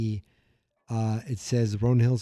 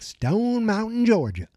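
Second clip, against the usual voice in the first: Georgia accent; 9 semitones higher than usual. Shown as a demonstration. Podcaster showing how the town name wants to be said, in a Georgia accent. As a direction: stretch it out in a Southern Georgia accent. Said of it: "to be said with a Georgia accent".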